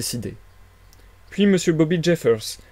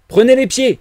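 high, loud strained shout; shout through clenched teeth; 11 semitones higher, 9 dB louder than usual. A narrator's reading. Policeman calling for help while wrestling the stranger, shouting through clenched teeth. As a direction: shout an order mid-struggle. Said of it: "cria Jeffers entre ses dents".

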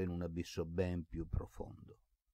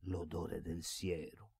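softer and slow, echoing it under his breath; to himself; 6 dB quieter, slower than usual. A narrator's reading. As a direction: repeat it privately, wounded. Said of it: "ripeté Basilio, parlando a se stesso".

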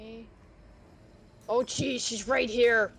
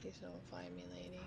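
whispering while hiding; 2 semitones lower, 12 dB quieter than usual. Real, not acted. Hiding from the lady.

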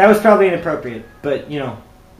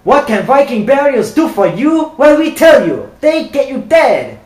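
raised, hammed-up sales pitch; mock ad pitch; 4 semitones higher, 3 dB louder than usual. Pitching Viking burials to the player like a cheesy ad. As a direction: sell it like a cheesy commercial.

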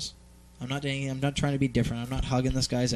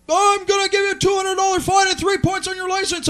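high, loud mock-cop threat; exaggerated shouted threat; threatening cop; 6 dB louder than usual. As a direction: yell the penalty up high like an irate officer. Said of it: "he keeps threatening me with this".

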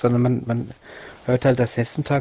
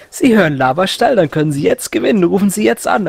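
lightly hammed-up radio host, higher and louder; radio contest announcer; 5 semitones higher, 4 dB louder than usual. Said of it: "so dämliche Gewinnspiele".

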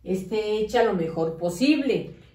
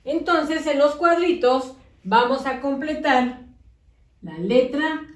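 put-on talking to young kids. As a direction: coax the children along through the worksheet. Teacher addresses small children.

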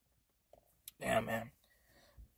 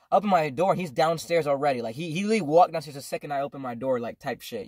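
ordinary talk

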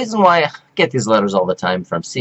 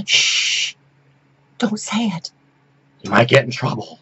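hushed warning, much softer; mock hush; 6 dB quieter than usual. Imitating scared people warning her, hushed. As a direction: whisper it like a secret. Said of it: "they're like".

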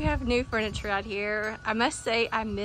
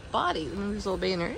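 usual speaking voice

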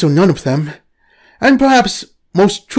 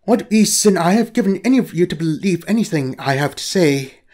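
ordinary delivery

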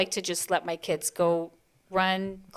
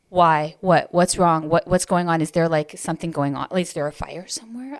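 louder, rapid bewildered questions; alarmed confusion; 6 dB louder than usual. Retold or imitated. Mother startled by the other mom's shock, louder and bewildered. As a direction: fire off the panicked whys. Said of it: "And I was just like".

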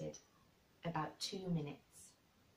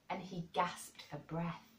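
ordinary delivery

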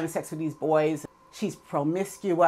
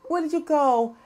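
tearful; 7 semitones higher than usual. Retold or imitated. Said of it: "He looks at her tearfully".

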